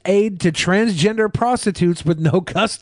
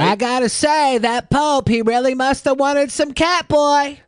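high, drawn-out joke voice; hammed-up high character voice; comic put-on voice; 8 semitones higher, slower than usual. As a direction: pitch it way up for a silly aside. Jokey put-on voice mocking the Pope.

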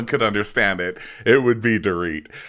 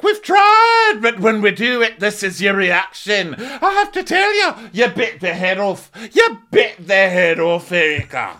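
much higher, louder exasperated scold; shrill mock scolding; 10 semitones higher, 5 dB louder than usual. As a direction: squeal it like an outraged friend. Mocking a shrill scolding castmate.

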